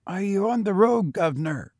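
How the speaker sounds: put-on high boy's voice, meant as husky. A narrator's reading. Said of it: "asked the boy huskily".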